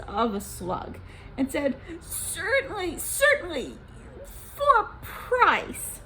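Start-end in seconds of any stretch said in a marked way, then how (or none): 2.0-6.0 high, very slow oily voice; sly official Gozan. Acted. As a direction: drag out the bargain like a sly slug.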